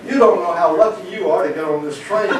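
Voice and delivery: high, loud acted exclamation; loud punchline exclamation. Someone grabs the man off the platform, loud exclamation. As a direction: call it out as the joke's rescuer.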